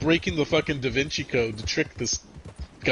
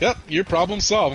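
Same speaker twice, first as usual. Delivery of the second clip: higher mock helper voice; breezy, pitched-up retold robot line; automated robot lady; 8 semitones higher, 4 dB louder than usual. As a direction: imitate an oblivious phone system. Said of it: "FedEx automated robot lady".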